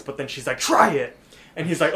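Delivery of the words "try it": higher, louder pushy command; insistent. Retold or imitated. LaDonna urging Mordecai to drink, insistent. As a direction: press them forcefully, imitating a pushy woman.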